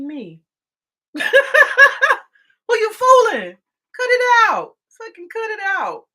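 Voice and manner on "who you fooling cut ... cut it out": much higher and louder, fed-up shout; loud exasperated outburst. Real, not acted. Ranting viewers about lying politicians, a real fed-up shout. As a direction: blast them to knock it off.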